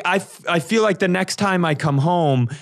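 ordinary delivery

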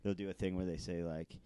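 normal talking voice

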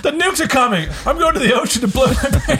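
high, loud laughing squeal; hammed-up doomsday wail; panicked skit voice. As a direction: shriek the doom through giggles. Mock panicked victim skit.

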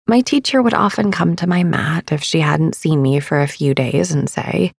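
ordinary talk

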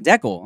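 "Decal" as DECK-ul, short "eh"; short-E "Decal" with swallowed second syllable. Retold or imitated. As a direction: say "Decal" to rhyme with freckle. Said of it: "And he was like".

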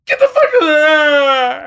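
high, loud, drawn-out wailing shriek; hysterical retold scream. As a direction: scream it out, stretching into a comic wail. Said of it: "It was like that".

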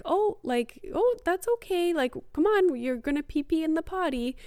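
put-on bright, high and cheerful, coaxing a toddler; upbeat potty-coaching voice to a toddler; happy voice. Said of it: "in a happy kind of voice".

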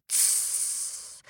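a very soft unvoiced fizzle. A narrator's reading. Fire monster fizzling out, very soft hiss. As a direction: fade out like a doused fire. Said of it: "and he fizzled".

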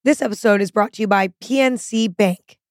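punchy advert opening; ad read. Acted. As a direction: sell it with a bright hook. Second sponsor read.

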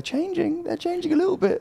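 mock falsetto aside; squeaky high voice. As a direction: squeak it up as if in disbelief. Mock-dramatic aside about Ferrari.

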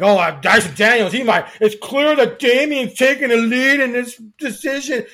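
mock a whiny eighth grader with a loud whine; mocking whiny fan voice. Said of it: "I know, I do the voice".

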